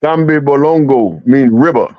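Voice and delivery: Kambi Balongo with a light West African lilt, gahm-beh boh-long-go; lightly put-on African accent for the guys. Shown as a demonstration. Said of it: "say it again in that accent".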